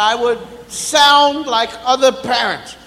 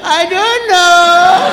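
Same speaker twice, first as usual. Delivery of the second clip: put-on slow, higher dopey kid drawl; dopey child voice; 4 semitones higher, 4 dB louder, much slower than usual. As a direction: drag it out like a clueless kid.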